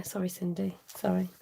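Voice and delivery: normal talking voice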